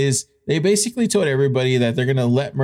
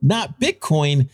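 ordinary delivery

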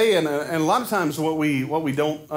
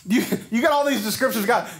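ordinary delivery

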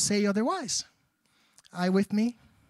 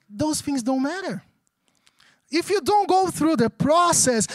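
ordinary talk

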